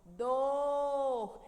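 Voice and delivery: DOR held long and loud; a loud, drawn-out DOR; stressed syllable alone. Shown as a demonstration. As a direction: call out dor on its own, stretched. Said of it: "o dor é a sílaba tônica".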